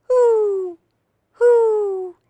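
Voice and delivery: high, loud, drawn-out hoots; owl hooting. A narrator's reading. Reader shows what an owl says, high hooting. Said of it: "asked the owl".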